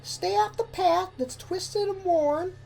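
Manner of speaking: high filly voice reciting; reciting a rhyme. A narrator's reading. Apple Bloom reciting her sister's rhyme, high filly voice. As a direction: recite it like a learned nursery rhyme. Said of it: "Apple Bloom recited the rhyme".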